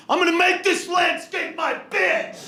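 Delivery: cocky boast. Retold or imitated. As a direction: ham up a cocky American outdoorsman. Mocking cocky Americans on survival shows.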